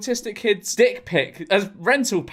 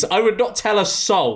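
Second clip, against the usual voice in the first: ordinary delivery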